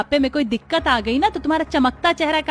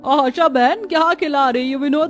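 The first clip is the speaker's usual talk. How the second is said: teasing neighbour; 5 semitones higher, 3 dB louder than usual. Acted.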